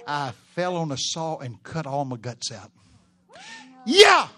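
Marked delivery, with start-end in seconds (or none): 3.9-4.3 loud, high yeah to the crowd; enthusiastic shout. Real, not acted. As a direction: fire up the room.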